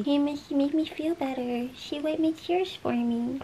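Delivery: high, soft cooing; soft, high-pitched doting voice. Real, not acted. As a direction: coo it like talking to a pet. Cooing about her comforting dog.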